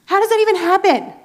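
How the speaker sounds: high, loud incredulous question; amazed disbelief. Real, not acted. Amazed at a lone man with a whip, high and loud. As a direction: ask it in sheer amazement.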